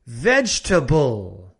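slow VEGETABLE as VEJ-tuh-bul; careful VEGETABLE with no vowel after the g; silent middle E. Shown as a demonstration. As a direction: model VEGETABLE in three beats, stress first. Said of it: "no se pronuncia".

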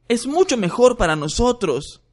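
put-on high, snide young voice; snide parting jab; sarcastic boy. Ron sarcastic to Hermione.

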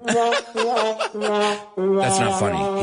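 laughing, slow denial. Real, not acted. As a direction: laugh while protesting.

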